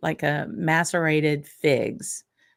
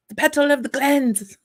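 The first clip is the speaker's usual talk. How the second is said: higher-pitched, hammed-up Scottish accent; a Southern belle butchering a Scottish accent; bad Scottish accent; 9 semitones higher than usual. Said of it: "I'm butchering my accent".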